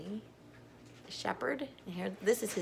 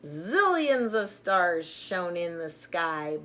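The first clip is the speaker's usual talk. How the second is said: lively, louder storybook reading; reading to children; 8 dB louder, slower than usual. Acted. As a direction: read it out like a picture book for little ones. Reading alphabet book aloud.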